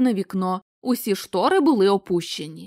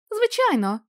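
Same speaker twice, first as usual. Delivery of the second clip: much higher, bright glad yes; gladly agreeing; 11 semitones higher than usual. Acted. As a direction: agree happily, eyes elsewhere. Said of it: "радісно погодилась Елісон". (радісно, значно вище)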